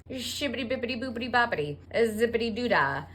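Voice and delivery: fast mock-holy gibberish; playful rapid-fire babble; speaking in tongues. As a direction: rattle off nonsense like a fake spirit trance. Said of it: "speaking Holy Spiterian".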